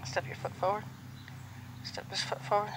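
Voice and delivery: soft, low-key command to the horse; quiet request. Real, not acted. Trainer quietly asking the mare to step forward. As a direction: ask her gently to step.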